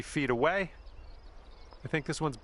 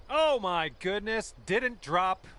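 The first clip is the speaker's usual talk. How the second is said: surprised; 6 semitones higher, slower than usual. Real, not acted. Near-miss putt in golf game.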